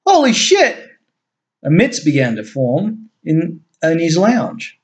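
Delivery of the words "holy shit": high startled yell. A narrator's reading. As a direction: jump and yell it out. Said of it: "yelled out".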